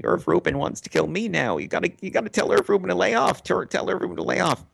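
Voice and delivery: high, fast whining panic; whiny plea. Retold or imitated. Shermer runs to his friend, fast high whining plea. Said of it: "run to Alex Grobman crying".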